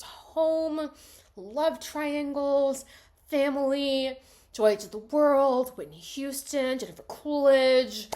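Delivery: high, loud, slow gushing list; real delight, listing favourite things; gushing excitement. Real, not acted. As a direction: rave about every item. Gushing list of movie highlights.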